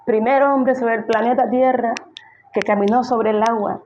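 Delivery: high, gushing mock praise; mock applause. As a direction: cheer him on like a proud fan, hammed up. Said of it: "que Jesús le aplaudiera".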